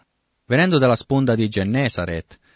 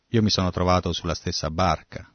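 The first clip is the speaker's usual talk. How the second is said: ordinary talk, nothing marked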